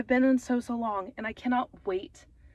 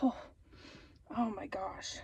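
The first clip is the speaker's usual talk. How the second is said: breathless, much softer whisper; overwhelmed whisper; 2 semitones lower, 11 dB quieter than usual. Real, not acted. Vlogger overwhelmed by her news, whispering to herself. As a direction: whisper it, overcome.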